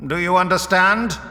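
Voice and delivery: commanding leader Vorus. A narrator's reading. Vorus gives his engineer four hours, commanding leader. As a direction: lay down the deadline with authority. Narrator voices Vorus towering, ordering.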